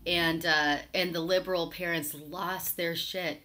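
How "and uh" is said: ordinary talk, nothing marked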